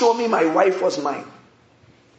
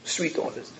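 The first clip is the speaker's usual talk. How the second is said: "Sweet odors" breathed in a soft whisper; whispered echo; 5 semitones lower, 11 dB quieter than usual. Acted. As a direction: savour "Sweet odors" in a hush, no voice. Preacher echoes phrase hushed.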